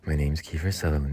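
low, soft, sinister voice; Kiefer Sutherland. Shown as a demonstration. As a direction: keep it low and quietly menacing. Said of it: "kind of a sinister".

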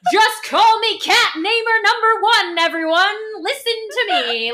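high, loud, proud proclamation; boastful announcement. Retold or imitated. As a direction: crown yourself champion to the room. Teasing friend's boastful voice.

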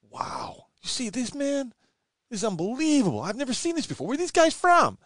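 high, excited American fan voice; American accent. Retold or imitated. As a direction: gush like an amazed American fan. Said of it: "how's my American accent".

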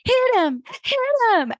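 high-pitched mock cheering; hammed-up football fan yelling.

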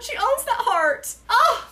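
high, slow swooning gush over owns. Real, not acted. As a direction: stretch owns with delight. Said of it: "swooning over the quote".

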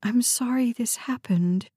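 usual speaking voice

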